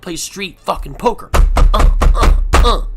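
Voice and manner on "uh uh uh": loud breathy uh uh uh; loud stammer. Real, not acted. Stammering loudly before a rant, sputtering.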